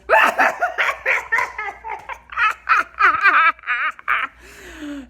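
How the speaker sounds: long, loud, high cackle; hyena cackle. Retold or imitated. Husband laughing while wife freaks out, imitated hyena cackle. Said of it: "like a hyena on drugs".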